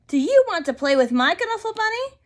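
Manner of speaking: put-on eager little girl offering; girl's offer. One friend to the other, high eager child.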